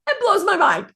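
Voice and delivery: high excited burst of amazement; amazed. Real, not acted. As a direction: gush it, blown away.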